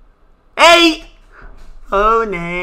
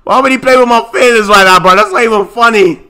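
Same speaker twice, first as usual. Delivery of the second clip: mock hurt rant; 7 dB louder than usual. Real, not acted. Loud mock-hurt complaint to chat.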